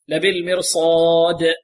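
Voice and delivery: slow لبالمرصاد, long aa then a bouncing release on the final d; qalqalah. Shown as a demonstration. Teacher shows that the dal is echoed, qalqalah on لبالمرصاد. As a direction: let the last d of لبالمرصاد echo briefly. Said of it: "والدال هنا مقلقلة".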